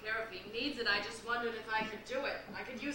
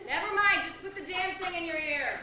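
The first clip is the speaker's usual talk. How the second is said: exasperated; 6 semitones higher, 5 dB louder than usual. Acted. Exasperated at deaf woman.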